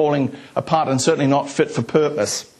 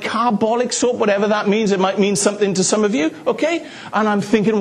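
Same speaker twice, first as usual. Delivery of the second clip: usual speaking voice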